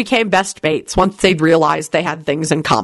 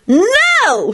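shouting. Acted. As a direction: yell it at the top of her voice. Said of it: "shouted Solange at the top of her voice".

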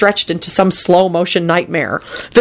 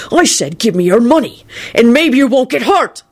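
higher-pitched, insistent robber; screamed threat; 5 semitones higher than usual. A narrator's reading. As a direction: repeat the demand as a mild yell. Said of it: "the man screamed".

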